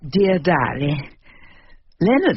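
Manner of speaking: slow, deliberate opening of a diary entry; diary reading. Acted. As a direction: savour each word as you begin writing. Wife begins her diary entry.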